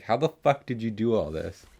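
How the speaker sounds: ordinary talk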